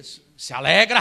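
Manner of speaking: loud, drawn-out command; strong insistence. Retold or imitated. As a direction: ring it out like an order from God. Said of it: "muito forte no meu coração". (ordem forte e enfática)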